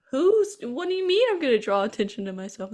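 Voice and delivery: high-pitched incredulous question; baffled Dave. Retold or imitated. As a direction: pitch it up in innocent confusion.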